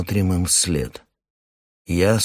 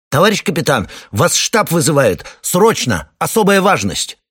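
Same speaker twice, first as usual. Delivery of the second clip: loud, high urgent report; urgent shout; 9 semitones higher, 5 dB louder than usual. Acted. Radioman runs in shouting.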